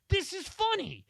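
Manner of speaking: put-on high-pitched, delighted childish tone; amused kid voice. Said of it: "And I was like".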